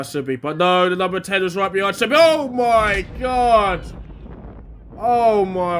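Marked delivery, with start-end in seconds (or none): 2.1-5.8 a slow, drawn-out, louder cry of disbelief; shocked shout. Real, not acted. Commentator reacting to the opponents' opening goal, shocked shout. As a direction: horror as the other side scores.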